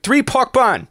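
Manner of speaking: high shouted call to the kitchen; mock restaurant yell. Restaurant lady screaming an order to the kitchen, high mock shout. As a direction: yell it to the back like a busy server. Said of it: "screams to the back".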